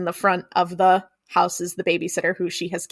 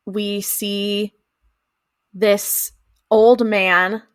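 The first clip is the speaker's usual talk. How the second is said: ordinary talk, nothing marked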